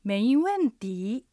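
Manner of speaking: slow メイウェンティー, each syllable drawn out with rise, fall, rise; slow tone demonstration. Shown as a demonstration. Teacher models the phrase for learners, slow tone demonstration. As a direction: stretch メイウェンティー so every tone is clear. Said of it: "アクセントと合わせて発音してみましょう".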